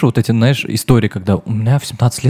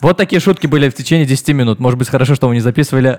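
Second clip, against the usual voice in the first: normal talking voice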